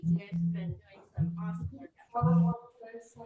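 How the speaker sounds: loud, beaming praise for the class; enthusiastic praise. Real, not acted. Yoga teacher cheering her class, loud and excited. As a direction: cheer them on with a burst of volume.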